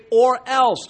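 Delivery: OR ELSE punched loud and high; blunt warning. Retold or imitated. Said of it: "just like that".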